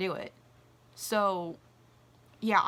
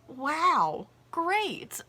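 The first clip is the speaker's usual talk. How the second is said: sarcastic; 6 semitones higher, 5 dB louder than usual. Retold or imitated. Tipped a nickel.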